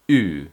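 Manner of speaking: "Ü" alone, loud rounded German vowel. Shown as a demonstration. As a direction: hold an isolated German "Ü", loudly. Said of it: "pronounced like the German sound".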